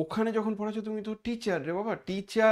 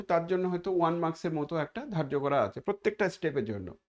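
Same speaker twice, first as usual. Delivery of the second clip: ordinary delivery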